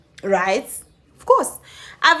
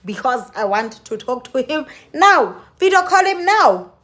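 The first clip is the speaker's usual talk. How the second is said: angry demand; 3 semitones higher than usual. Retold or imitated. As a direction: demand it now, fuming. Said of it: "I'm like".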